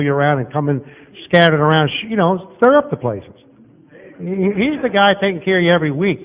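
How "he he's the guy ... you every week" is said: ordinary talk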